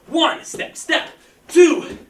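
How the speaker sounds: loud, high counting of reps; energetic coach calling out reps; shouted count. Real, not acted. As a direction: bark out each number to drive the class.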